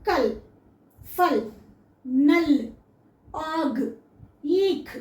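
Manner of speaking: "కల్, ఫల్, నల్, ఆగ్, ఈక్" each ending on a bare consonant; one short word at a time, long pauses, no final vowel on "కల్" or "ఈక్"; half second letter. Shown as a demonstration. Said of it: "రెండో లెటర్ని మనం ఆఫ్ పలుకుతాం".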